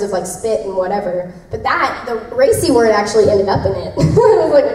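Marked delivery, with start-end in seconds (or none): none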